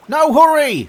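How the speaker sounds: high, loud character voice; obliging carpenter. Acted. Carpenter letting the tired oysters rest, high call.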